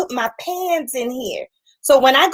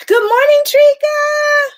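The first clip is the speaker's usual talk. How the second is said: excited greeting; 6 dB louder, slower than usual. Real, not acted. Greeting a viewer on livestream.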